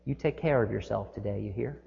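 tender farewell. Retold or imitated. Toll lady grabbing driver's arm, soft tender farewell. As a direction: send him off kindly. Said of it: "And she said".

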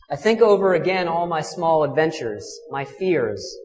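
heartfelt poem reading; poem recital. Acted. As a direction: read the old poem with wonder. Reads an Inuit poem.

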